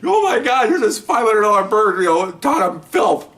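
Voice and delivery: high-pitched, tickled disbelief; mock hysterics. Detectives laughing at the pricey bird, high mock hysterics. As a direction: imitate onlookers in stitches, voice way up. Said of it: "Everybody's in tears, just laughing".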